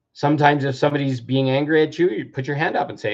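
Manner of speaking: usual speaking voice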